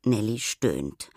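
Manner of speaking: usual speaking voice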